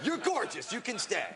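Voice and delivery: hammed-up judge praising a contestant; mock gushing judge. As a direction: gush over them through a laugh. Judges on Are You Hot.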